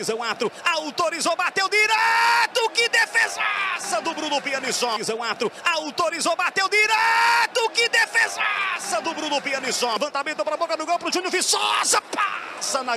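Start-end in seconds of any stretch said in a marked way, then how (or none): 0.6-5.0 grito alto e agudo no chute; narração empolgada. Real, not acted. Grande defesa do goleiro. 5.6-9.9 grito alto e agudo no chute; narração empolgada. Real, not acted. Replay da grande defesa.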